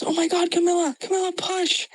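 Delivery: very high, frantic mother's cry; panicked mom. Retold or imitated. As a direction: plead like a scared mom in the corner. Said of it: "I heard my mom, she goes".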